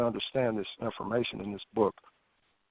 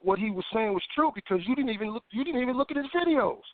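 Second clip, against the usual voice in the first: imitating a contradicting inner voice, pitched up; lightly acted higher-pitched voice in the head; nagging thought; 7 semitones higher than usual. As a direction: push back as the pestering thought, rising high. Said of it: "the next thought was".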